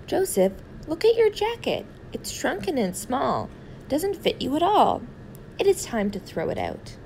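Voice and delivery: high-pitched fussy mother voice; a mother fussing over a worn-out blanket; scolding mother. Acted. Mother scolding about jacket.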